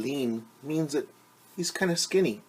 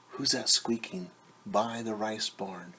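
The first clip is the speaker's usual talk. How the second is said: ordinary delivery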